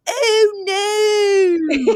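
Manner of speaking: hammed-up Delco "Oh, no"; drawn-out Delco. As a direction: stretch "Oh, no" into a wailing Delco drawl. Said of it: "when you draw out a certain word".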